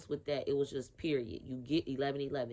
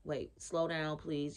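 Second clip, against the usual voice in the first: ordinary talk, nothing marked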